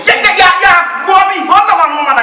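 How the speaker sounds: angry outburst. Acted. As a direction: cut him off hard and loud. Girlfriend erupts at boyfriend.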